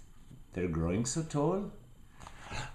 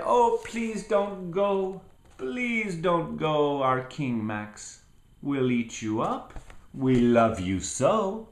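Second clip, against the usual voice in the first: pleading wild things; 7 semitones higher, 3 dB louder than usual. Acted. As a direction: beg the king not to leave. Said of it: "the wild things cried".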